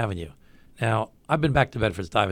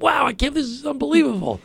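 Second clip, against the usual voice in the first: high-pitched, wowed exclamation; retold amazement; 12 semitones higher than usual. Telling of the exploding bamboo, amazed and high-voiced. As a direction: relive the astonishment, pitch way up. Retells his amazement at the fire.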